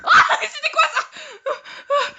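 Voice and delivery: very high, startled cry; real panicked shriek. Real, not acted. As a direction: shriek in shock at a jump scare. Jump scare in horror game.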